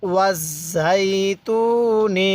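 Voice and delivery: ওয়াজাইতুনি joined and drawn out very slowly; slow recitation. Shown as a demonstration. Teacher revising ওয়াজাইতুনি with students, very slow. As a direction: stretch ওয়াজাইতুনি syllable by syllable. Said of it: "কিভাবে শিখব?".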